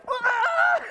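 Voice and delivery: high happy squeal; excited kids' squeal. Retold or imitated. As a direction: shriek with joy like the children. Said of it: "and they're like".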